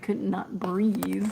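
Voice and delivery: could not breathe drawn out slowly; slow, stretched. Real, not acted. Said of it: "I can't even talk".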